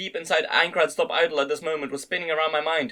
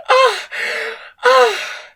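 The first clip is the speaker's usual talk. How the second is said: high loud cries; 8 dB louder than usual. A narrator's reading. Woman cries out at climax.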